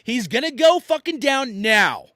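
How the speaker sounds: loud, high threatening shout at a runner; yelling as loud as he can. Retold or imitated. Soldier shouting at a man running at his squad, loud high yell. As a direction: bellow the threat to shoot. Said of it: "I'm yelling as loud as I can".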